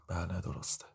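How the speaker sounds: very soft, muted reply; stifled voice. A narrator's reading. Defendant confirming her details to the judge, stifled and very quiet. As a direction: answer under your breath, barely audible. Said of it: "ناهید با صدای خفه جواب داد".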